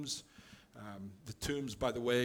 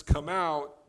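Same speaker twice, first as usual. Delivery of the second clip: louder, higher quoted command; retold command; 4 semitones higher, 9 dB louder than usual. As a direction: punch out a brief retold order. Jesus commands the spirit to leave.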